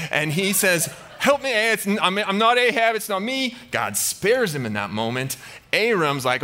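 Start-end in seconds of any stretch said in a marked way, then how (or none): 1.2-3.6 high-pitched, frantic mock pleading; hammed-up begging for your life; panicked plea. Jehoshaphat mistaken for Ahab, high panicked pleading. King pleading not to be killed.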